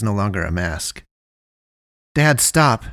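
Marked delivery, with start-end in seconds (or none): 2.1-2.8 higher, louder desperate plea; pleading. A narrator's reading. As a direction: beg him to stop.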